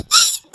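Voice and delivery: short high giggle; teasing laugh. Acted. Wife giggling teasingly at husband after his apology. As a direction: laugh at him, playfully.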